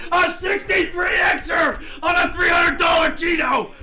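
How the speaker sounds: high, drawn-out victory yell; real jackpot elation; triumphant shouting. Real, not acted. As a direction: holler it slowly, savouring the win. Screaming about a big win.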